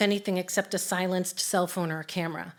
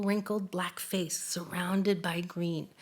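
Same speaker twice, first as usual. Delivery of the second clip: whispering; 3 semitones lower, 8 dB quieter, slower than usual. Real, not acted. Awe at first gorilla sight.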